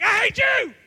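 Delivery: high, loud return shout; yelling back. Retold or imitated. Girlfriend's friend answers him across campus, high mock yell. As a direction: fire the yell straight back, mimicking her. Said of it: "And she yelled back".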